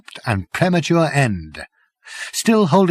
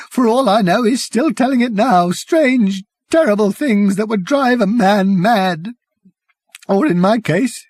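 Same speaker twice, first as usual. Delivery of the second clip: higher and louder, savage bitterness; 8 semitones higher, 3 dB louder than usual. Acted. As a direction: spit it out savagely. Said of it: "said the man savagely".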